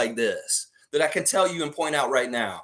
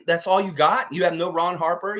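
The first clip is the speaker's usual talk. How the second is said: ordinary talk, nothing marked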